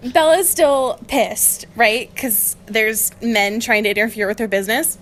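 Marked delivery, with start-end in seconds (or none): none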